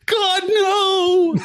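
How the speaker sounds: high, loud cry of a falling man; a high, loud yelp as he goes over the edge; anguished scream. Retold or imitated. Comic reader voicing a man elbowed into a vat, screaming high and loud. Victim shoved into boiling vat.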